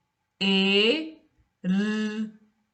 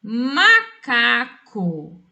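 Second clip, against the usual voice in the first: "Macaco" said as one word, drawn out very slowly; whole word, very slow; 4 dB louder, much slower than usual. Shown as a demonstration. Teacher giving the correct word to pupils, very slowly. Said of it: "A palavra macaco".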